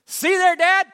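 put-on very high, loud, proud little boy; child's voice. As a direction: crow it like a kid who was right. Son voiced in retold story.

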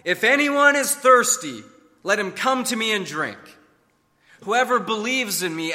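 high, loud public proclamation. Acted. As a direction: call it out over a crowd. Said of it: "said in a loud voice".

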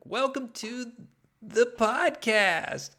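high, slow show-host welcome; announcer voice. Pretend. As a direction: stretch it like a cheesy host. Mock podcast welcome.

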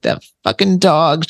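high, slow imitation of a thick Jersey accent. Medium voicing a laughing spirit's thick Jersey accent. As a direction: ham up a brassy New Jersey woman cursing the dog. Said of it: "thick Jersey accent".

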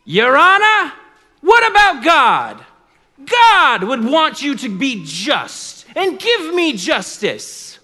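high, loud, impassioned appeal; pleading widow. Acted. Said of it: "She would play on his other emotions".

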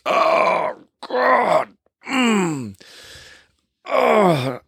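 high, drawn-out groans of pain; pained moaning. Retold or imitated. Injured stuntman moaning on a gurney. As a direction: moan like a man with a broken hip. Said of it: "moaning and groaning".

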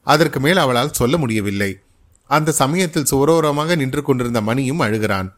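ordinary delivery

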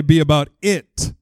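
slow, drawn out, landing on IT; stress on IT. Shown as a demonstration. Preacher's third prompt to the congregation, slowed with stress on "it". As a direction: stretch it out and land on "it". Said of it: "put different emphasis on different words".

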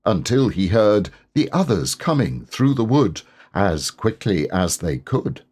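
bouncy sing-song verse; recited poem. A narrator's reading. Pooh reciting the Piglet hum to himself, sing-song. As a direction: chant it gleefully like a nursery hum.

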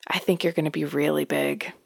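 hushed, almost whispered verdict; retold deadpan. Old woman to pregnant midwife, hushed deadpan. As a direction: imitate a blunt remark, softly and straight. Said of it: "she looks me dead in the face".

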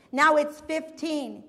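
ordinary delivery

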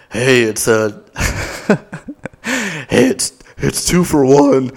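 hammed-up old stripper voice breaking into laughter; mock old stripper come-on; caricatured old stripper. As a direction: put on an exaggerated old-lady stripper voice, barely holding it together. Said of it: "No, she didn't talk like that".

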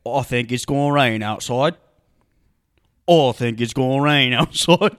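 loud, thick mock British delivery; exaggerated comic British accent; British character voice. Shown as a demonstration. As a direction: put on a dopey British character, rising at the end. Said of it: "Can you say it again?".

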